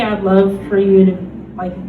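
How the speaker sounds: ordinary delivery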